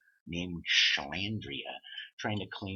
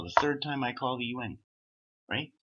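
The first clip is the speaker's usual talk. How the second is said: ordinary delivery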